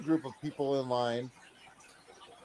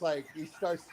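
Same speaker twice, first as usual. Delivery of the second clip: ordinary delivery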